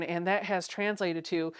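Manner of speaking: normal talking voice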